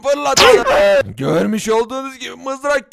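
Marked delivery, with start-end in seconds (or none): 0.0-1.1 slow, loud showman's call; dramatic announcement. Acted. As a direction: stretch it out like a circus showman. Magician throws the spear.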